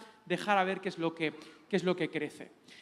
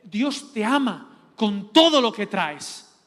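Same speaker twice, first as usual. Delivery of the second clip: slow, loud and weighted on TODO; emphatic proclamation; 8 dB louder, slower than usual. Real, not acted. Preacher assuring listeners God takes them as they are, slow emphatic proclamation. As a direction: declare it with force, word by word. Proclaims his point to congregation.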